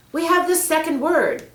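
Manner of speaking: put-on excited boy. Peter shows Mary the glowing word, thrilled. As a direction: burst out with glee.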